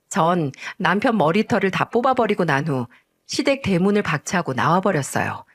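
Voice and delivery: ordinary delivery, nothing marked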